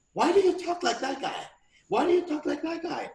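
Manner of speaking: high-pitched mimicked ex asking; imitated ex. Retelling ex's question about switching speech, high imitation. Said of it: "one time she asked me".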